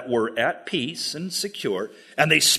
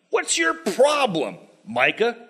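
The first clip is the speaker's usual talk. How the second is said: mocking taunt; 6 semitones higher than usual. Danites mock Micah.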